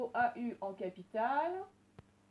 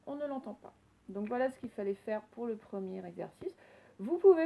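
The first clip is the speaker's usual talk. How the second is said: usual speaking voice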